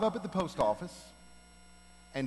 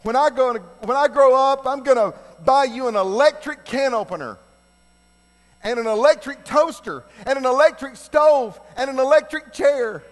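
put-on higher, louder, earnest boyish pledge; eager little boy; 8 semitones higher, 10 dB louder than usual. Little boy pledging devotion to his strict mother, eager and loud. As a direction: gush like a devoted kid building to a punchline. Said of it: "he says".

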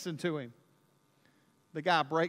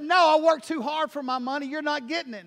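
loud, higher retold refusal; indignant refusal; 6 semitones higher, 8 dB louder than usual. Preacher telling the mugger he won't pay, loud. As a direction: stand your ground indignantly.

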